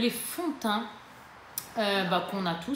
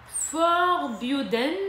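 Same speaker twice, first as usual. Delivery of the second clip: slow French-accented English; bad English accent; 5 semitones higher, much slower than usual. Real, not acted. Vlogger reading the lipstick shade name, bad English accent. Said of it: "mon accent pourri".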